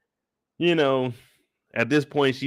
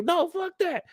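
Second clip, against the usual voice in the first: much higher mock mom voice, yelling; shrill angry mom. Outraged mom shouting down the staff, higher mock fury. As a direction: squeal it like an outraged mother. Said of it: "angry she was with Candy".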